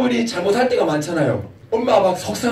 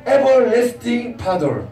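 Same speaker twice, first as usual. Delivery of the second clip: put-on each syllable stretched very slow for the kids; slow English model; 3 semitones higher, much slower than usual. Shown as a demonstration. 아이들에게 따라 하도록 아주 천천히 또박또박. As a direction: spell out Everlasting Father slowly so children can copy. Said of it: "영어로 뭐라 그래요?".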